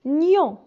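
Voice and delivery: gnon said loudly and clearly as ny plus a nasal on; syllable demo. Shown as a demonstration. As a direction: blend gnon for the pupils. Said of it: "se prononce".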